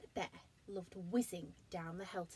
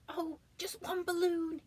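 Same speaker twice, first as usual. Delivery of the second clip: pleading cry; 10 semitones higher, 4 dB louder than usual. Acted. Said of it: "he cried".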